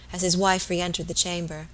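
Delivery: usual speaking voice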